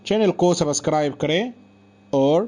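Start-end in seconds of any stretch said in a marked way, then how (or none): none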